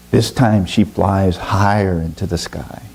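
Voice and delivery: ordinary delivery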